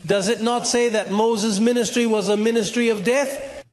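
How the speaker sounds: ordinary talk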